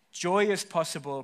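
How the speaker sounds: normal talking voice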